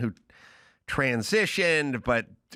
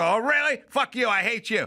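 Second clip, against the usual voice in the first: put-on kid's whiny outburst, pitched up; mock bratty outburst; 6 semitones higher than usual. Teen swears at stepdad in a pitched-up tantrum. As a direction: throw a teen tantrum at the parent. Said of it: "Then you go".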